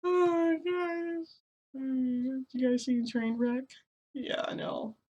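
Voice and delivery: high, slow, loud squeal of delight; excited squeal. Real, not acted. Gushing to viewers about Trainwreck, loud high squeal. Excited outburst after laughing.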